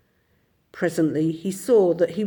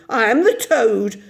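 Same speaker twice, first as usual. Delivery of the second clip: louder, slowing grand boast; reckless boast; 4 semitones higher, 6 dB louder than usual. A narrator's reading. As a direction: crow about who he really is. Toad boasting wildly.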